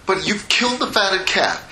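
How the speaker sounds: high, aggrieved protest; indignant son. Acted. Older son accuses his father over the feast, indignant. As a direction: throw it back at your father. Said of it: "So he was really indignant".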